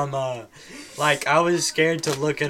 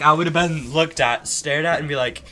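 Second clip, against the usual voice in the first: ordinary talk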